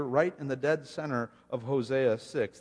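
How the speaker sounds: usual speaking voice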